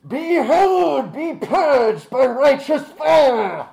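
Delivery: high, booming holy war cry; battle cry. Acted. Caster calling down holy fire on guards, shouted incantation. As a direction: bellow it like righteous judgment, drawing out the end. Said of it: "And then I go".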